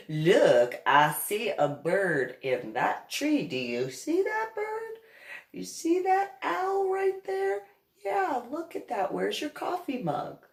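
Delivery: put-on high sing-song distraction, as to a child; exaggerated high-pitched pointing-out; acted coaxing. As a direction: draw his attention away with mock wonder. Retold coaxing of someone to distract him.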